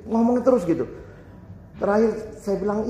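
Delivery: normal talking voice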